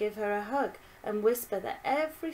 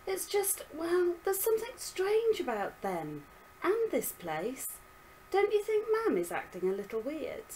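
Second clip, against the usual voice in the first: put-on higher uneasy girlish voice; worried girl voice; 10 semitones higher than usual. Violet worried.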